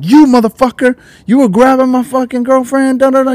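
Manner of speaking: high, heated mock accusation; a hint of a furious boyfriend; angry accusation. Boyfriend accusing Mario, high, heated mock anger.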